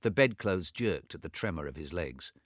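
ordinary delivery, nothing marked

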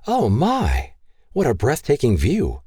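lifted, breathless wonder at the view; delighted exclamation. A narrator's reading. Roxanne seeing the ranch for the first time, delighted. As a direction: gasp it out in awe. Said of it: "Roxanne exclaimed".

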